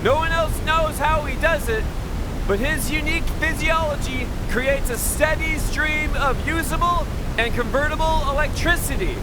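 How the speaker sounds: high, loud showman announcement; announcer voice. Acted. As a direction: present it like a fair barker. Presenter introduces the generator.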